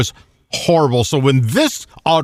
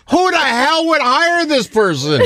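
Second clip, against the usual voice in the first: incredulous outburst; 9 semitones higher than usual. Retold or imitated. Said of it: "I was like".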